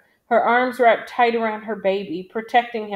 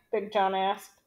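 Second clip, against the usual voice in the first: ordinary delivery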